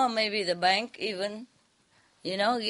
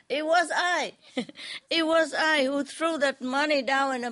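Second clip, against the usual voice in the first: crying plea; 6 semitones higher than usual. A narrator's reading. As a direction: plead through tears, voice pitched high. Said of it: "but crying".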